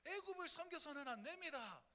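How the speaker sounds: a lightly voiced tearful prophet; pleading prophet. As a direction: plead with the people like Jeremiah. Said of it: "눈물의 선지자라고 해가지고 이렇게 얘기하면".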